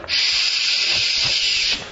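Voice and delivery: long drawn-out mock shush. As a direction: shush someone in a library. Acted shushing of loud davener.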